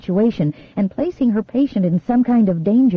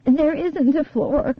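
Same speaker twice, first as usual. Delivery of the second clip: higher, slow trance voice; dazed girl voice; 7 semitones higher, slower than usual. A narrator's reading. Hypnotized girl answers.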